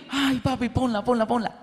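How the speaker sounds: put-on a light child's voice, begging; eager kids asking to play it; a hint of excited little girls; pleading little girls. Daughters begging for the movie.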